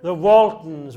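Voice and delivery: proud loud reveal of "The Waltons"; loud answer. Real, not acted. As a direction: announce "Waltons" loudly to the room. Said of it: "Anybody recognise this picture?".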